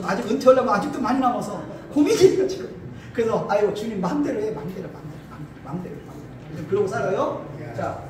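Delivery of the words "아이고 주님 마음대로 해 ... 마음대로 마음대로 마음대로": from soft sigh down to a breathless murmur; resigned plea growing ever softer; fading resigned prayer. Retold or imitated. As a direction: let it die away into nothing.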